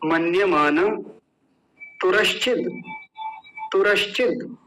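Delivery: paired words, long pauses for repetition; joined in twos. Shown as a demonstration. Teacher joining words in twos for students, repeat-after-me. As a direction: join each pair, then wait for the echo. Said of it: "दो दो जोड़ेंगे एक साथ".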